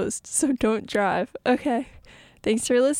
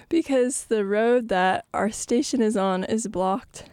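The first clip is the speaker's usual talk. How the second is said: ordinary delivery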